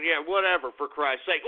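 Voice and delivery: usual speaking voice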